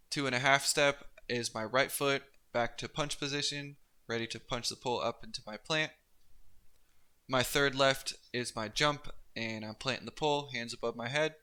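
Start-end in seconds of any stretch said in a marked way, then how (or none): none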